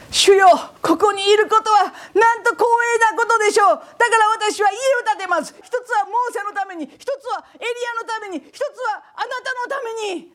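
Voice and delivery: high, loud, laughing delight; Peter gushing. Retold or imitated. Peter on the mountain thrilled before Jesus, high excited gushing. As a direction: gush with eager joy. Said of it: "ペテロが言いました。".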